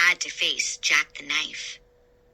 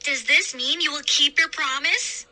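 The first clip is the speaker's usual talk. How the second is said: demanding; 7 semitones higher than usual. Acted. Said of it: "I demanded".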